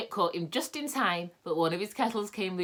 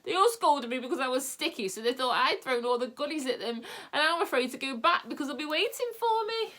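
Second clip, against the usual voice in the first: higher, tearful complaint; 9 semitones higher, 4 dB louder than usual. A narrator's reading. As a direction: whimper it to the children. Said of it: "wept Moonface".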